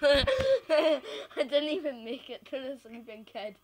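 normal talking voice

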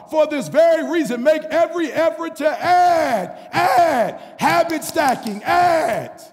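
loud preacher hammering the point home; full-force preaching. Acted. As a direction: drive each line home to the congregation.

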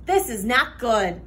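usual speaking voice

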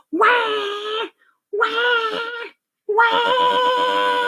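high, drawn-out mock wails. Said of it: "cried even louder".

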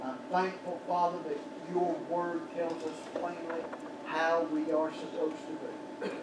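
usual speaking voice

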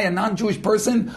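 normal talking voice

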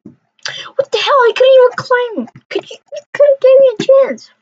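high, loud whiny protest; indignant outburst. Real, not acted. Gamer complains high and loud after being killed unfairly.

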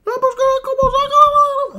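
shrill, very high, loud yelling neighbour; loud high-pitched mock neighbour yell; neighbour impression. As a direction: scream it way up like the angry neighbour. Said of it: "pour l'imiter, il faut un peu gueuler".

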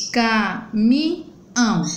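"Caminhão" slowly split into ca, mi, nhão; three separate beats on "Caminhão"; syllable by syllable. Shown as a demonstration. Teacher counting three syllables, "Caminhão" split apart. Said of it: "Eu falo três vezes".

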